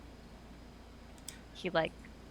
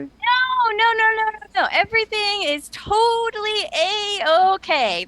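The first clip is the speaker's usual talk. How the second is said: high-pitched, insistent denial; overdone reassurance; 11 semitones higher, 4 dB louder than usual. Acted. Character denying trouble on their shoulder, high and overeager. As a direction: rattle off the no's high, protesting too much. Character hastily denies a problem.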